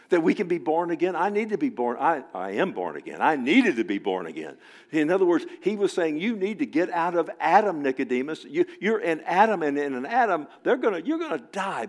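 loud, high, urgent sermon voice; excited preaching. Acted. Preacher stirring the congregation about being born again, loud and urgent. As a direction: build the excitement and drive it home.

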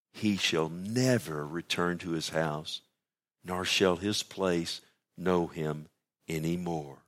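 slow, low, sombre reading; tragic and sad. Acted. Preacher reading Job's words from the grave passage, slow and sad. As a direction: let each phrase sink slowly. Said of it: "tragic, sad words from the lips of Job".

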